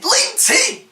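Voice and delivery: LinkedIn! shot up very high in disbelief; incredulous. Real, not acted. Vlogger mocks sharing a Hull article on LinkedIn, incredulous. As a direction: blurt it out, stunned at the idea.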